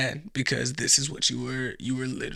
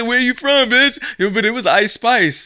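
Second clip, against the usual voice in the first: loud, higher mocking challenge; mock tough girl; 8 semitones higher, 8 dB louder than usual. Imitating the loser boasting, loud and higher. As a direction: bark it like a bragger. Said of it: "And then I said".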